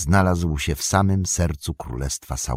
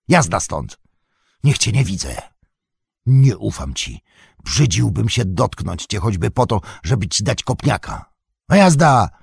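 angry scolding; 3 semitones higher, 3 dB louder than usual. Acted. Sam drives Gollum away angrily.

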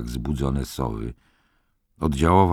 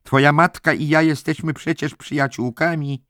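high-pitched, insistent matron; reproachful head nurse; 12 semitones higher, 4 dB louder than usual. A narrator's reading. Head nurse threatening to tell Billy's mother, reproachful. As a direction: remind him pointedly, voice lifted well up.

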